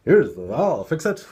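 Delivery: mock the guy who jumps to fix everything; masculine fixer. Speaker parodying the masculine urge to fix, hammed-up and loud. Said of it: "that's a masculine thing to do".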